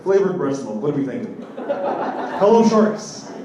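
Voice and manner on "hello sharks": mock pitch. Pitching to investors.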